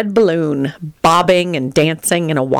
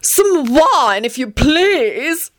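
high, lordly demand for wine; haughty shout; 9 semitones higher, much slower than usual. Acted. Tony shouting his order at empty seats, high and lordly. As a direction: bark the order like a pampered lord. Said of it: "he shouted".

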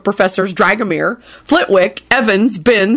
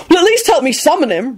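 cross; 9 semitones higher than usual. Acted. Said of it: "Ron told them crossly".

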